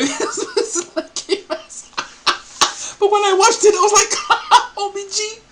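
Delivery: very high words choked by real laughter; laughing uncontrollably. Real, not acted. Host recalls first watching it, unable to stop laughing. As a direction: squeeze the words out between laughs. Retelling first viewing while cracking up.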